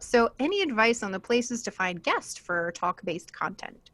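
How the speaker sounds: ordinary talk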